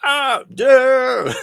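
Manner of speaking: loud, high pained scream; "Ah, yeah" screamed like a game sample; death scream. Shown as a demonstration. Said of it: "the sample with him going".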